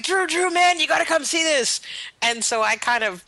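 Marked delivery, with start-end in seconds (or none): 0.0-1.8 much higher, eager calling voice; imitated excited urging. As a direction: play someone rushing in to fetch a friend. Imitates man running in to fetch Drew.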